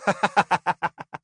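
high-pitched acted laugh. A narrator's reading. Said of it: "lança Yukiri en riant". (éclat de rire aigu)

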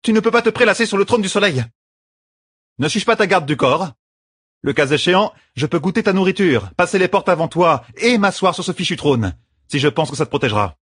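high, teasing banter; teasing bodyguard. Acted. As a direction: tease the queen. Birgit defends herself. (taquinerie de garde du corps)

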